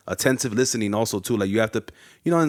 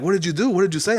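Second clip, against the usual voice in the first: alarmed classmates; 8 semitones higher, 4 dB louder than usual. Retold or imitated. Classmates demanding what he said.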